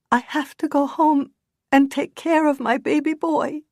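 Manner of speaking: high, tearful plea. Acted. Woman pleading tearfully with Tommy. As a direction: play up the tears for Tommy. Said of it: "her tear-filled eyes".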